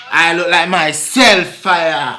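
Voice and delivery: put-on emphatic Jamaican accent. Insisting on his own looks in a Jamaican accent. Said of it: "You just did that accent".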